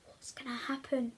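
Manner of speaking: hushed suspense whisper. Real, not acted. Reader whispering a cliffhanger question to viewers.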